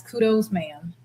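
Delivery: usual speaking voice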